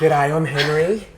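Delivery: slow, drawn-out Australian G'day; sheepish Australian hello; broad Aussie greeting. Retold or imitated. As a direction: lay the Aussie on thick, slowly. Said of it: "I didn't do".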